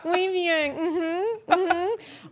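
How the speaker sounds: high, loud sing-song encouragement with a shaky laugh; cheering on her son. Retold or imitated. Mom cheering her toddler down the climbing frame, high and sing-song. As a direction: coax him on in a bright high voice, hiding the fear.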